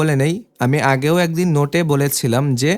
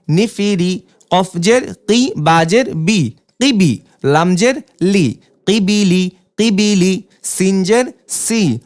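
teacher's spelling drill cadence; syllable drill reading; slower than usual. Shown as a demonstration. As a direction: clear letter-by-letter spelling chant.

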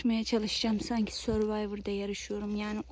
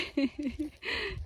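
light laugh; 5 semitones higher than usual. Real, not acted. Dog leaves.

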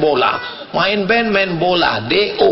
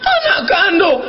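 high, emphatic retort; acted motherly protest; indignant mother; 11 semitones higher than usual. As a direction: answer back in a high, affronted voice. Mother answers son's doubting question.

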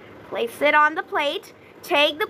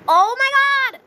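high, loud shocked cry; shocked shout; 11 semitones higher, 7 dB louder, slower than usual. Real, not acted. Gamer shouting as a rat steals the lettuce. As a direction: yell it in disbelief.

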